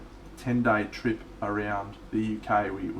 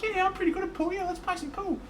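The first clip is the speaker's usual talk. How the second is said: very high, excited mate's impression; high-pitched goofy mate voice; the Blythe voice. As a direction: squeak it out like a clueless, delighted bloke. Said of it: "The Blythe voice".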